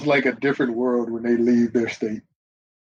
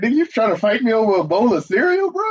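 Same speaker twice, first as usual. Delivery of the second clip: mock challenging, a bit raised; added emotion; 7 semitones higher than usual. Shown as a demonstration. Demonstrating to friends how to add emotion, mock challenge. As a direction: play it like picking a fight over cereal. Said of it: "I added emotion to the line".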